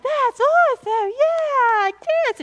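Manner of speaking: high, loud squeal of delight; squealing excited women. Retold or imitated. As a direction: gush like thrilled ladies at a lunch. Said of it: "all these women are like".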